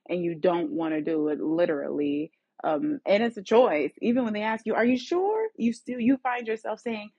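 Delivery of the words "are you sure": much higher, rising question; high checking question. Acted. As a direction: voice someone gently double-checking. Voices someone checking on a people pleaser.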